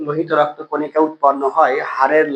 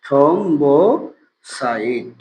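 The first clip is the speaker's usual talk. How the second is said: slow drawn-out term; 2 semitones lower, much slower than usual. Shown as a demonstration. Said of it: "teacher repeats term slowly".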